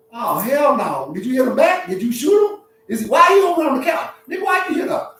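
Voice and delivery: high, rising mock outrage; acted outrage. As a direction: build to a loud, indignant interrogation. Acts out counselor's outrage.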